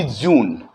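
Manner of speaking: "जून" with a soft English j; "जून" said with a soft j, not a hard one; soft J June. Shown as a demonstration. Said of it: "soft sound के साथ जून बोलेंगे".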